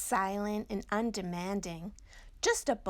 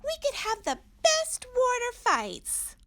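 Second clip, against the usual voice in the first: very high, louder gleeful voice; excited; 7 dB louder than usual. Acted. Little Owl excited to Mommy about water fights. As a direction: bubble over like a thrilled kid.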